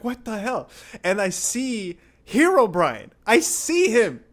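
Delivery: high voice building to loud alarm; acted freak-out. Acts out seeing Herobrine, freaking out.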